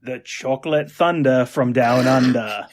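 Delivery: slow, hammed-up Aussie billing; playful put-on Australian accent. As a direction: draw it out in a broad Australian accent. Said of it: "your tremendous Australian accent".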